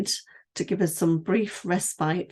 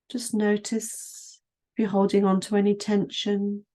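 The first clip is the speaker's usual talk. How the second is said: guided meditation; 2 semitones higher than usual. Acted. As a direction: ease the listener down through the body, unhurried. Leads a standing body scan.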